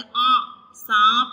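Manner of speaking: nasal आ into सांप; nasal vowel. Shown as a demonstration. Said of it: "नाक से हम आवाज़ निकालें".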